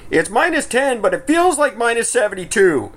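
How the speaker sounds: high-pitched mock weather report; exaggerated forecaster delivery. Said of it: "I hear your reports on our show".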